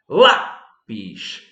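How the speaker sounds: Lápis split in two, LÁ punched loud; stress on penultimate syllable. Shown as a demonstration. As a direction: hit LÁ hard, then drop on pis. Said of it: "sílaba tônica na penúltima sílaba". (Lápis silabado, com força no LÁ)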